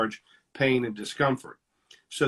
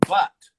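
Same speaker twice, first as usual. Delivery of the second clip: "but" hit sharply, much higher and louder; punched but; 6 semitones higher, 10 dB louder than usual. Retold or imitated. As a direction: slam the word "but" hard and high. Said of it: "everything before the but".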